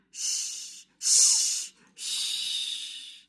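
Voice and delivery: breathy shh like pebbles into water; whispered shh, shh, shh of falling pebbles; soft shushing. Acted. Reading to child, imitating pebbles in water with soft shushes. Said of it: "like pebbles dropping into shallow water".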